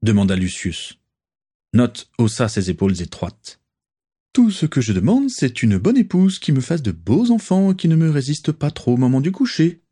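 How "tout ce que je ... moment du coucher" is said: voix nettement plus aiguë, lancée avec entrain; exclamation enjouée. A narrator's reading. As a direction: clamer ses exigences, fier de lui. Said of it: "s'exclama-t-il". (Nott proclame ses désirs à ses amis, plus aigu et enjoué)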